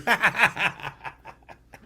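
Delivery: a real laugh breaking out; high, falling laugh; high burst of laughter. Real, not acted. Laughs at own memory.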